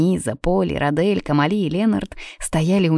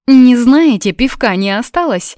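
higher, louder hopeful question; 7 semitones higher, 7 dB louder than usual. A narrator's reading. Даниза asks if any beer is left, hopeful. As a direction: ask with eager hope. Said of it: "с надеждой в голосе".

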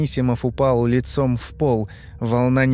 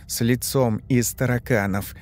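plain reading voice

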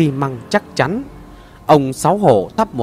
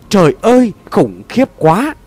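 horrified cry; 5 semitones higher, 3 dB louder than usual. Acted. Boy sees the small corpse, cries out in horror. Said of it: "kêu lên đầy kinh hoàng".

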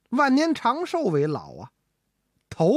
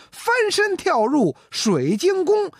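slow, high, triumphant poem recitation; recited verse; 10 semitones higher, slower than usual. Acted. Ji Xiaolan caps the verse before drinking his tea, slow and high. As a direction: deliver the winning line slowly and proudly.

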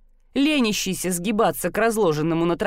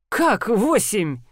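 stunned, drawn-out question; flabbergasted customer; shocked slow disbelief; slower than usual. Acted. Fat man shocked at price. (ошеломлённо)

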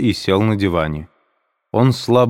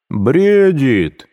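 louder, higher tipsy shout; drunken shout; 7 semitones higher, 5 dB louder than usual. A narrator's reading. Пьяный Разумихин кричит на всю комнату, громко. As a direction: bellow it out, a little drunk. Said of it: "закричал хмельной Разумихин".

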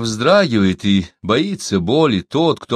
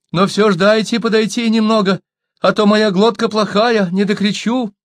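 high, wheedling excuse; storyteller voicing a captive's plea; pleading; 11 semitones higher, 4 dB louder than usual. A narrator's reading. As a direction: beg them in a high, raised voice. Captive pleading to come closer.

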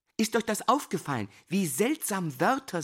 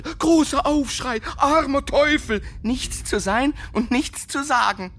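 higher and louder, building cry of the poem; loud declamation; 7 semitones higher, 7 dB louder than usual. Acted. Teacher reciting his sonnet to the class, loud impassioned declaiming. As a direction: cry out the verse's climax.

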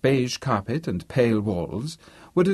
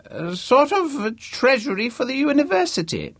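put-on very high-pitched old man; hammed-up ancient don explaining loudly. A narrator's reading. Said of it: "his voice loud, old, and very convincing".

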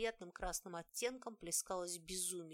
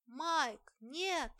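high desperate cry of a name; anguished scream; 8 semitones higher, 7 dB louder than usual. Acted. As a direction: scream it out in horror. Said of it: "подавилась немым криком".

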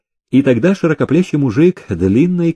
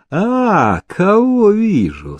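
high, drawn-out mock sweetness; honeyed, lilting hail; sugary call; 8 semitones higher, much slower than usual. A narrator's reading. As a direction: greet him with syrupy delight. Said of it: "слащавым голосом окрикнул он Ивашку".

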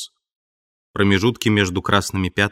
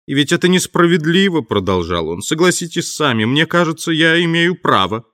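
higher, whining protest of unfairness; aggrieved Marvel; 6 semitones higher, 3 dB louder than usual. A narrator's reading. Marvel complaining to the invisible man of injustice. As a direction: plead that it isn't fair.

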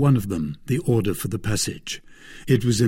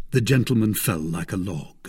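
usual speaking voice

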